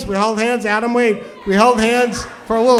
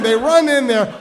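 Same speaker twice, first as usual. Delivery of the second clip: ordinary delivery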